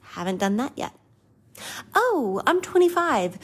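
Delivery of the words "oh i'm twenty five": high, whiny self-pity on Oh, I'm twenty-five; mocking inner voice. Mocking her own inner voice on turning twenty-five, whiny and high. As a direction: mimic your own sulky thoughts, pitched up. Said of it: "my mindset has been".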